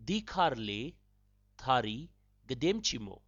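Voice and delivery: "Dikhar le, thari, gademchi mo" said slowly with pauses; slow teaching take of "Dikhar le thari gademchi mo", in separate chunks. Shown as a demonstration. Host repeats the how-far question for learners, slowly.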